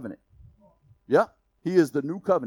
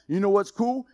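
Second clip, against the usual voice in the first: ordinary talk, nothing marked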